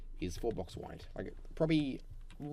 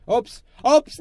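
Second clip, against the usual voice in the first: yelped oops; 12 semitones higher, 18 dB louder than usual. Real, not acted. Mistake in building.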